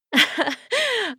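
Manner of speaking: a high, incredulous cackle; scornful laugh. Acted. Captor cackles at the vampire's offer.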